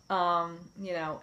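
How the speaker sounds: ordinary talk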